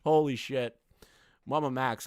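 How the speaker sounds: ordinary talk, nothing marked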